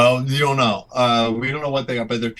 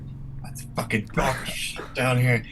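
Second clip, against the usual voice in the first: ordinary talk, nothing marked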